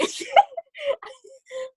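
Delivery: a sudden shrieking burst of laughter; cracking up in a high squeal. Real, not acted. Laughing at her own steak remark.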